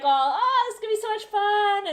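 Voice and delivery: loud, high, over-animated tourist; really loud. Acted. Traveller pretending to be an American tourist, really loud. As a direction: gush at top volume like a hammed-up American. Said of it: "So let's talk really loud".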